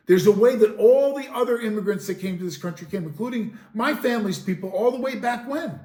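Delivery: loud, heated ranting; angry rant. Real, not acted. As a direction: hammer every point home, fired up. Angry rant about illegal immigration.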